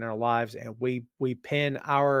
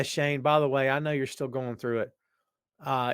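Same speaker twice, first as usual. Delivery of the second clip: usual speaking voice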